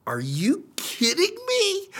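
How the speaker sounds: high, drawn-out incredulous squeal; acted-out exasperated disbelief; disbelieving outburst. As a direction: stretch it out in high-pitched disbelief. Retells his disbelief at TV.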